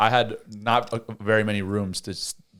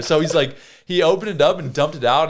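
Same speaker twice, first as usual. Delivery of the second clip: ordinary delivery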